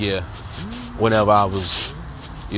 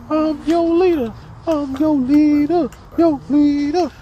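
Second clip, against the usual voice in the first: mock Mister Davis voice. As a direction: squeal it like a goofy teacher calling the class to order. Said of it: "he had this deep, this deep voice".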